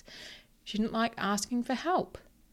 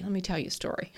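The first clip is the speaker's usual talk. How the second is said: whisper; 6 dB quieter, faster than usual. Real, not acted. Aside before a story.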